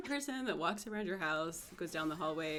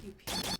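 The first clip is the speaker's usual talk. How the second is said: thin, very high pew-pew; high-pitched pew noises. Shown as a demonstration. Actor repeating the attack sound on request, high-pitched pews. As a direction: squeak out two toy-like shots. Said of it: "One more time".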